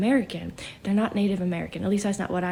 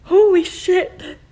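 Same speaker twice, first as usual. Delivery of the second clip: loud, high, slow gasp of disbelief; real stunned exclamation, much higher and louder; shocked outburst; 12 semitones higher, 11 dB louder, much slower than usual. Real, not acted. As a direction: blurt it out, truly stunned. Sudden shock during channeling.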